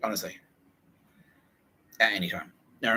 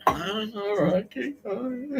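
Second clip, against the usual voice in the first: slow, high put-upon surrender; weary giving in; slower than usual. Retold or imitated. Writer gives in to the nagging coach, slow and high. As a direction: drawl a reluctant okay, pitched way up. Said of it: "writer gives in to coach".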